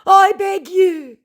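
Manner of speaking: very high, loud, slow pleading voice; begging scammer. Retold or imitated. Scammer begging her, very high and loud. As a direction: wail it like a desperate beggar. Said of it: "he begs".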